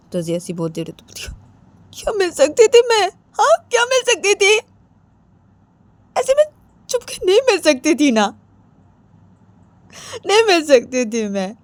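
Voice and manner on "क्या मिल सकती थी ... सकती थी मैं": high wail sinking by the end; wounded, wailing denial; tearful protest. Acted. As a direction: protest in a crying voice, then subside. Tearful denial in acted quarrel.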